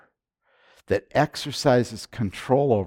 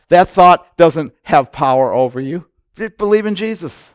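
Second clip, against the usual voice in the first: loud, high, driving pulpit voice; rising sermon fervour, hammering each point; full-force preaching; 5 semitones higher, 8 dB louder than usual. Acted. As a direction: preach it hard to the congregation.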